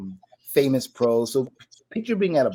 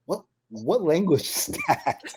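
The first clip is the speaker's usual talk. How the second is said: slow, higher, breaking into laughter; retold bafflement dissolving into laughs; amused bafflement; 5 semitones higher than usual. Newcomer asks the Welsh girl about her accent, amused bafflement.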